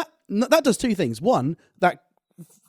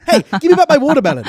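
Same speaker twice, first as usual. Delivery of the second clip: loud, high, fast mocking shout; 12 semitones higher, 10 dB louder, faster than usual. Friend's joke retold as a loud mock shout. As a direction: yell it in a parody accent. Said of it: "shouting in a racist accent".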